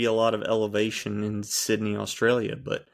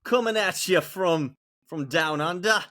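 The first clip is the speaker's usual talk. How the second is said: put-on playful Australian accent, pitched higher and slowed down; mock Australian accent; 11 semitones higher than usual. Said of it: "that was my best Australian impression".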